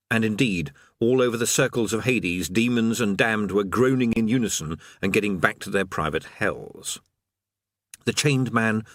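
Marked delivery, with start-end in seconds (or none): none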